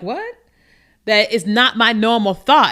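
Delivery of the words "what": startled what. Retold or imitated. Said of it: "I'm like".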